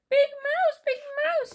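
very high, loud mouse squeal; calling Little Mouse. A narrator's reading. Little Mouse wakes snoring Big Mouse, high loud calling. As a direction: call the name in a high squeak. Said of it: "he called".

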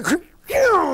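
very high whooshing swat sound; mock swat noise. Uncle Dolphus slings a bumper car across the track, high whoosh.

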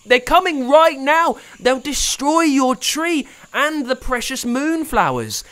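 high, loud, urgent warning; rally everyone fast; panicked shouting boy; urgent alarm. A narrator's reading.

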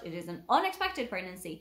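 normal talking voice

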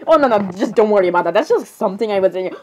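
normal talking voice

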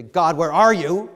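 loud challenge thrown at God, rising at the end; loud, confrontational question; disrespectful demand. Shown as a demonstration. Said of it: "say that two different ways".

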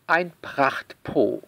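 ordinary delivery

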